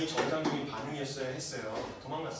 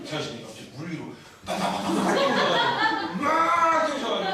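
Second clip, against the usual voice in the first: wild excited telling; 11 semitones higher, 11 dB louder than usual. Acted. Comic picture of angry Jesus.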